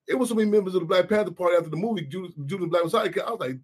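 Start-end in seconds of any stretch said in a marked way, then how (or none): none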